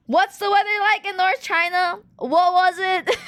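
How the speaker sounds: teasing mom about the weather; annoying teasing. Retold or imitated. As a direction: needle mom playfully. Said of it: "to be as annoying as I can".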